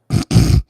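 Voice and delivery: short loud real laugh; burst of laughter. Real, not acted. Witness bursts out laughing, unsure which case is meant. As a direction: blurt out a laugh at the question.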